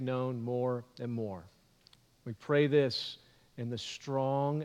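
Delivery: prayer. Acted. Pastor praying over the congregation, hushed and reverent. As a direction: pray it quietly and humbly. Opening prayer before the sermon.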